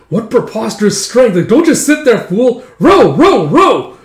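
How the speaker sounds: loud, high-pitched barked orders; urgent yelling. Acted. Captain yelling at the fool to row, urgent. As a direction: drive the stunned rowers on.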